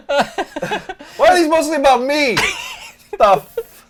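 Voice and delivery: laughing through the words. Real, not acted. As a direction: giggle while you ask. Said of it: "laughing while reading comments".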